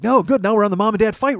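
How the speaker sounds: put-on high, loud, gleeful kid; excited little brother. Little brother delighted his parents will fight, excited. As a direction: imitate a small boy thrilled by the next ride. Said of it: "my little brother is like".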